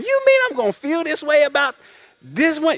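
high, doubtful question of shock; can't believe what they're hearing; incredulous disbelief. Retold or imitated. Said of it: "for the life of them, imagine".

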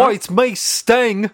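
high, loud mock Cockney voice. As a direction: ham up a British rocker. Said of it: "That's my [name] impression".

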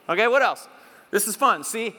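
normal talking voice

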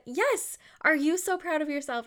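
put-on high-pitched, delighted mom-to-kid voice; retold gushing encouragement, pitched well up; excited praise. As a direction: cheer on a proud little kid. Retells her encouraging reply to son.

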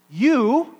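"You" punched high and loud; "You" as a booming emphatic opener. Shown as a demonstration. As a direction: hit "You" hard, ringing out. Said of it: "begins with that emphatic pronoun".

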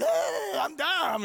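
high excited yell mid-race; hyped-up Dom. Retold or imitated. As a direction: play the runner full of energy, crowing his name. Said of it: "he's all over the road, like".

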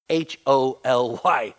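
H O L Y, each letter spoken slowly and separately; each letter of H O L Y given its own weight; spelled out slowly. Shown as a demonstration. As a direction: spell H O L Y out deliberately, one letter at a time. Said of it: "spells holy slowly for emphasis".

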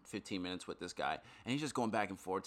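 ordinary talk, nothing marked

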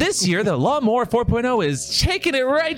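high, hammed-up sports hype; peppy co-host announcer. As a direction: play the excited commentator, punching the product claims. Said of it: "I'm the really, like, peppy".

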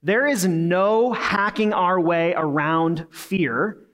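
the big idea, each word spelled out slowly; slow and weighty, so it sticks; slow, deliberate. Shown as a demonstration. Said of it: "And it's our big idea. It's this".

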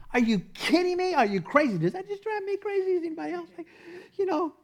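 high, incredulous rant; can't believe what you just saw; exasperated disbelief. Acted. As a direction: rant in high-pitched exasperation at a blundering player. Mock outrage at offside players.

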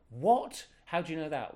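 high, loud startled "What?"; surprised. Retold or imitated. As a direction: yelp it in disbelief. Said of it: "retold phone dialogue".

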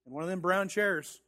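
ordinary talk, nothing marked

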